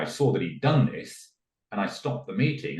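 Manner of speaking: ordinary talk, nothing marked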